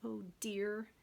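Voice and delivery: ordinary delivery, nothing marked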